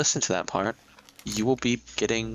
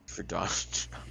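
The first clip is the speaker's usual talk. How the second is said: whispering; 4 semitones lower, 8 dB quieter than usual. Pretend. Confesses vote.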